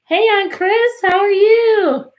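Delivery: bright high call, slowly drawn out; high excited greeting. Real, not acted. Teacher greeting her aunt on a live class, high and excited. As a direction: greet her like you're thrilled to see her.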